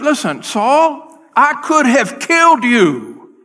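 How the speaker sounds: slow, loud, high warning; stern warning. Retold or imitated. David warning Saul he could have killed him, slow loud. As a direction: lay it down slowly and forcefully.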